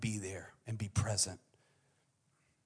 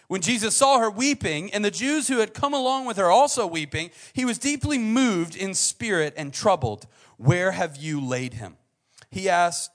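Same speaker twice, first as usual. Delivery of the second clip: loud, raised dramatic Bible reading; scripture reading; 9 semitones higher, 12 dB louder than usual. Acted. Preacher reading John eleven aloud, loud and dramatic. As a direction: proclaim the verses to the room.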